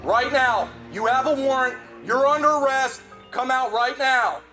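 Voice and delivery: barking police commands. Real, not acted. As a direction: bark it at him. Police ordering suspect out.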